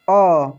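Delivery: emphatic vowel; forceful अ. Shown as a demonstration. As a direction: punch the vowel अ. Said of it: "दूसरे को जोर लगा के बोलना है".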